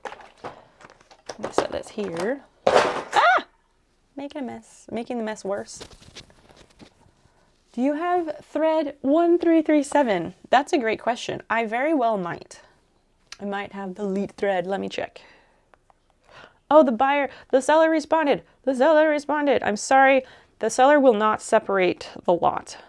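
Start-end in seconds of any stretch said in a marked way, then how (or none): 2.7-3.5 a sudden high yelp of surprise; startled yelp. Real, not acted. Drops something, making a mess. 16.7-22.8 loud, higher, urgent reading of bad news; agitated outburst. Real, not acted. Reading seller's reply aloud, agitated.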